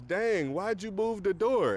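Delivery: put-on high, whiny girl voice; squeaky teen complaining; Supergirl voice. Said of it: "And then she goes".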